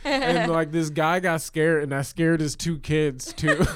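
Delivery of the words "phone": high fast shout, laughing; a laughing fake robbery shout; mock shout. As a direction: yell it like you're robbing them. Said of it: "I was like".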